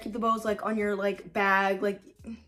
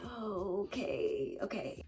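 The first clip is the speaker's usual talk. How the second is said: unimpressed murmur; 11 dB quieter, much slower than usual. Retold or imitated. Retelling her reaction as it kept going.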